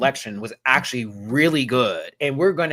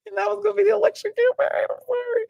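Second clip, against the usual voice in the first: high-pitched, talking through laughter; laughing; faster than usual. Real, not acted. Vlogger apologising for cracking up at own joke, laughing. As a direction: squeeze the words out while cracking up.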